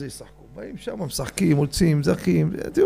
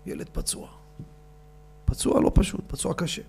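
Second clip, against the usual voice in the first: hushed whisper of bad news; 8 dB quieter than usual. Preacher telling the congregation the child is hurt, whispered. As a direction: drop to a grave whisper.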